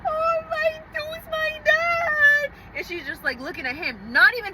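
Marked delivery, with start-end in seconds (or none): 0.1-2.5 much higher, very slow mock-sobbing wail; imitating sobbing. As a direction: wail it like a crying teenager. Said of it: "I'm looking at her sobbing".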